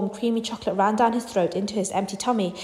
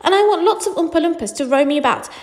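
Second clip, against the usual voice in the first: put-on high, insistent child's demands; greedy, high-pitched demanding; 6 semitones higher than usual. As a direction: pile up the wants, pitched up and pushy. Spoilt child listing demands.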